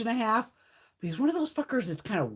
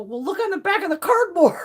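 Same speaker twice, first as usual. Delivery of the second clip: higher, louder called-out answer; calling out; 9 semitones higher, 6 dB louder than usual. Retold or imitated. Telling the friend to check the cardboard, calling out higher. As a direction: call it across the room, much higher. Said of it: "I go".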